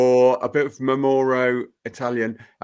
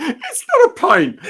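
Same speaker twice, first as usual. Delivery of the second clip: high giggly protest through laughter; squealing with laughter; laughing protest; 4 dB louder than usual. Real, not acted. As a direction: laugh while denying it. Laughing protest about glass size.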